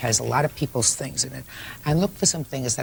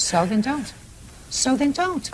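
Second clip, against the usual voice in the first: high, insistent retort climbing higher; exasperated; 10 semitones higher, slower than usual. Real, not acted. Judge shutting down a litigant's protest, exasperated. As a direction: cut them off, pitch rising on the repeat.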